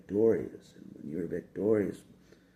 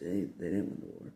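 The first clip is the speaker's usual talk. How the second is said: ordinary delivery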